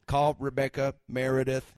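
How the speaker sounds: flat robotic command voice. Acted. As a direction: speak like a robot to Siri. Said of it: "in a very robotic voice".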